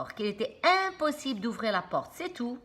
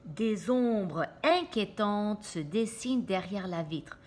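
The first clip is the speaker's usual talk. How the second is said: ordinary delivery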